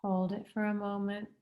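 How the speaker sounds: measured count with long pauses, each word drawn out; guided breathing. Real, not acted. Instructor counts participants through box breathing, calm guided-meditation voice. Leads a paced breathing exercise.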